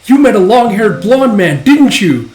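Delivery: high, loud accusing outburst; mock accusation. Coworker confronting Yor about her date, mock accusing. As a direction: pounce on a supposed culprit.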